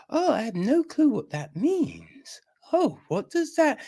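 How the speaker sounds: high, put-on clueless voice; mock clueless viewer. Mocking viewers who miss the reference, high and clueless.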